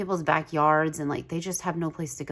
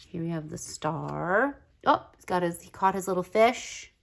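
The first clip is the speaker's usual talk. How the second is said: ordinary talk, nothing marked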